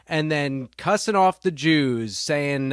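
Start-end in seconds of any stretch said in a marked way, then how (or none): none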